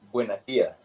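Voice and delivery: "buena dia" with both s sounds dropped; Cuban dropped s. Shown as a demonstration. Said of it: "it's not buenos dias".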